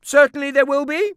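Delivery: high, loud falsely cheerful aunt; scheming aunt. A narrator's reading. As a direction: promise it too eagerly.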